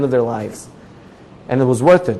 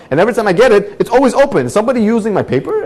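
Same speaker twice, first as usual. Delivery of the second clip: raised, louder protest to the paper; indignant complaint; 8 semitones higher, 3 dB louder, faster than usual. Retold or imitated. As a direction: complain to the newspaper with rising outrage. Man complains to newspaper about paper.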